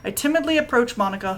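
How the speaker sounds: usual speaking voice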